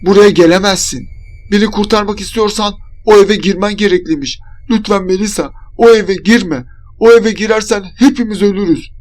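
high, urgent warning shout. A narrator's reading. Berna warning her friend off the house, shouting. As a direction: cry out a desperate warning. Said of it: "diye bağırıyordu".

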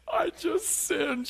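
high, slow whimpered confession; small childlike tearful voice; mock crying confession. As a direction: whimper it like a little kid. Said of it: "come out of the men's room crying".

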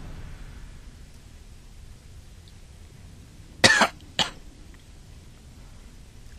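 long hacking cough; harsh coughing fit. Real, not acted. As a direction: cough through the burning. Coughing from spicy food.

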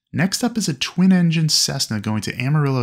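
ordinary talk

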